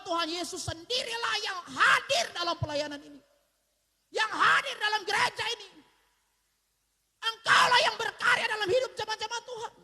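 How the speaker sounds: high, loud, pleading prayer; preaching-style prayer at full voice; fervent prayer. Real, not acted. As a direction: pour out a heartfelt plea to Jesus.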